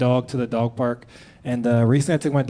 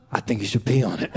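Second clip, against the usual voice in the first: lower, calm scientist suggesting it; the scientist's answer; 6 semitones lower, 3 dB quieter than usual. Retold or imitated. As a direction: drop the voice low and offer it matter-of-factly. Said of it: "And he goes".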